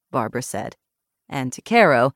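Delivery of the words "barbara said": ordinary delivery, nothing marked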